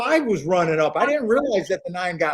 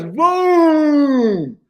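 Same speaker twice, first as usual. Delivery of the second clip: quoted shout; 5 semitones higher, 4 dB louder than usual. Retold or imitated. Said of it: "Disco says".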